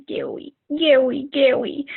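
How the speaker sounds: put-on high, slow toddler saying scary. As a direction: imitate a little boy's lisping scary. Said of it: "He goes".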